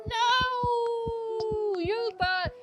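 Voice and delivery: high, crying protest at the husband; distraught protest. Pretend. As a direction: scold him while half-sobbing. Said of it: "I'm like".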